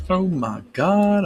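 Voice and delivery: slow amazed oh my God; surprised. Real, not acted. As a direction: draw it out, high and amazed. The merge finally works.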